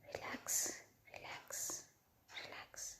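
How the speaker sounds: very soft whispered repeats. A narrator's reading. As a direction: breathe it gently against her lips. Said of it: "धीमी आवाज़ों में कह रही थी".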